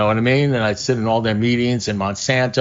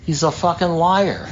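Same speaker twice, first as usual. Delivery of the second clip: owner's punchline; 7 semitones higher than usual. Retold or imitated.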